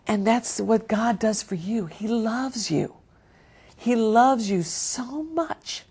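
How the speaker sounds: soft, slow and sincere; tender. Real, not acted. Preacher telling the listeners God loves them, soft tender voice. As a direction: let the love land gently.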